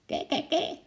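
high bird shriek in short bursts; frightened magpie screech. A narrator's reading. Magpie startled by the approaching ape, screeching. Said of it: "Krijsend van schrik".

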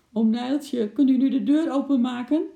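put-on high, loud girl's call. A narrator's reading. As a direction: shout across for help getting out. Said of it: "riep Lotje".

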